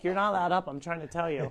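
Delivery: retelling what Brooklyn told the rooster, softly; quoting Brooklyn softly. Retold or imitated. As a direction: softly mimic the girl telling the rooster off. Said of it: "And she's like".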